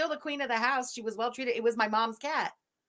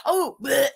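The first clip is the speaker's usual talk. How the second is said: mock retching bleh; vomiting; 9 dB louder than usual. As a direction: fake gagging noise, loud. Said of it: "When I do the vomit sound in my hideous voice".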